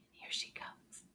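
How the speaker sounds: breathy hush before the shy friend peeks out; hushed whisper. Acted. Teacher whispering to children as a shy friend arrives. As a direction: whisper it slowly so she won't be startled. Secret arrival of second puppet.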